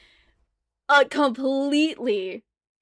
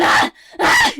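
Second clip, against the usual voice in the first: loud, much higher zombie shrieks; zombie screams; 9 dB louder than usual. Acted. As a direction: scream like a zombie smashing its face.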